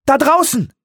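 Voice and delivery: hoher, scharfer Ausruf; aufgeregter Ausruf. Acted. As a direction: zeig hoch und laut hinaus. Weaver zeigt aufgeregt hinaus. (aufgeregter Ausruf)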